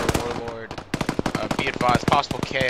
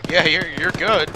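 loud reassurance; 5 semitones higher, 6 dB louder than usual. Real, not acted. Reassuring hit teammate loudly.

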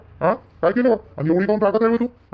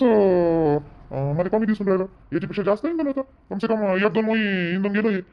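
usual speaking voice

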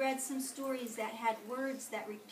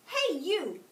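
higher, louder brash hail; grouchy call-out; 7 semitones higher, 8 dB louder than usual. A narrator's reading. Grouchy ladybug hails the yellow jacket, loud and brash. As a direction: call out a challenger rudely. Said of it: "said the grouchy ladybug".

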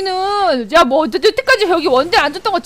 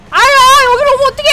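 high, loud whine of protest; pitched-up distressed complaint; exasperated wail; 6 semitones higher, 5 dB louder than usual. Real, not acted. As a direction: wail at the intruder. Enemy comes at him.

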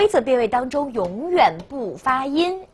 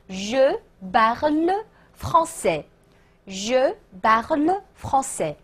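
French drawn out word by word; slow model sentence; 2 semitones higher, slower than usual. Shown as a demonstration. Teacher modelling the answer for learners, slow word-by-word. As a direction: separate je, parle and français slowly. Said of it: "请大家自己直接拼读啊".

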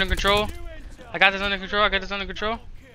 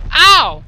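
loud, high "ow"; sudden loud cry of pain in play; pained yelp; 7 semitones higher, 10 dB louder than usual. Real, not acted. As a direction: yelp as you get hit. Gets hit in game.

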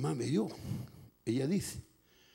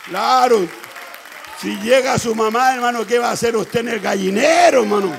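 very loud, raised-pitch shouted preaching; impassioned preaching; 7 semitones higher, 15 dB louder than usual. Real, not acted. Preacher urging the congregation about a mother's coming, shouting. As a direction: drive the point home at full voice.

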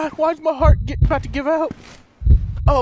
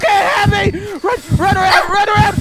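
high loud frantic shouting; panicked yell; 7 semitones higher, 4 dB louder than usual. Real, not acted. Player chased by a monster, frantic yelling. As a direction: shout while fleeing for your life.

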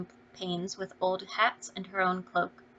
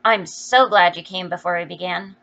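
impetuous exclamation; 8 dB louder than usual. A narrator's reading. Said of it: "Jo exclaimed impetuously".

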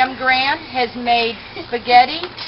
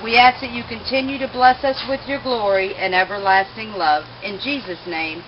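graveside prayer; 3 semitones lower, 4 dB quieter than usual. Acted. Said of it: "Let us pray".

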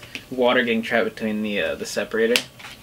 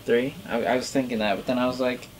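speaking through fingers; slightly muffled by hand; hand over mouth. Real, not acted. Seen 0: Left speaker's fingers cover his lips and nose throughout.

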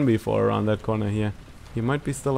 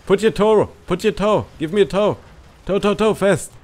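urgent; 6 semitones higher than usual. Real, not acted. Urgently asking for a tow.

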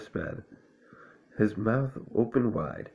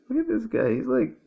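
high excited voice. Real, not acted. Laughing disbelief at Owl.

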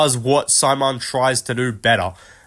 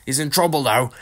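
mock excited fight commentary; imitated commentator outburst; Bisping commentary; 3 semitones higher than usual. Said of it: "Bisping's gonna just jump in and go".

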